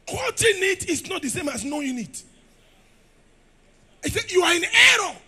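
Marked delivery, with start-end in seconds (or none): 4.3-5.2 loud, high declaration of error; shouted rebuke. Acted. Jesus telling the scribes they err, shouted. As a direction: hammer the verdict home.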